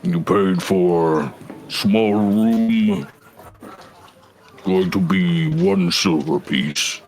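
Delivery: lightly voiced gruff orc, slow; slow, low gravelly orc demand. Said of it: "in a kind of deep, gravelly voice".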